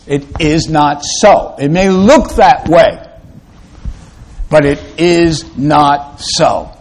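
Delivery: ordinary delivery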